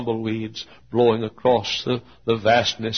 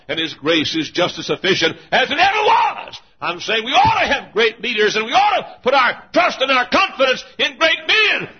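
full-force preaching climax; 12 semitones higher, 7 dB louder than usual. Acted. Preacher mourning fallen heroes to the congregation, shouting. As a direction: drag each name out in a rising shout.

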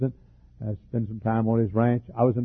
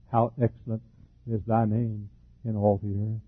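soft, reverent reading of a psalm; quiet psalm recitation that swells slightly toward the end; scripture reading; 7 dB quieter than usual. Real, not acted. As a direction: read the verses hushed, in awe. Reads Psalm 8 aloud.

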